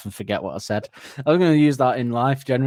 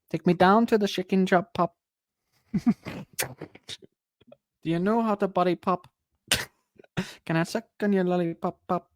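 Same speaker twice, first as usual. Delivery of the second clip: high, silly mock Welsh chant; high, playful mock lyric voice; mock lyric singsong; 8 semitones higher than usual. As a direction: fool around with the lyrics.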